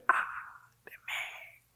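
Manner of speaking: a voiceless 'Ah, de mí' in place of a yell; whispered stand-in for a scream. Shown as a demonstration. Preacher whispers Isaiah's cry, throat too sore to shout. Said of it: "Ahorita no puedo gritar por, por la garganta".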